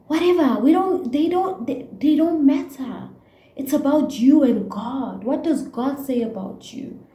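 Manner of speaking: upset rant. Real, not acted. Vlogger venting to her viewers about toxic relationships, worked up and loud. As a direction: let the frustration burst out. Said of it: "got me so upset".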